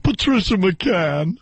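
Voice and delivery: drawn-out radio commercial voice; name slowly drawn out, mock ad-reader; Patricia McCann. Host mimicking a radio voice he hates, mock announcer. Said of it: "She's got that voice".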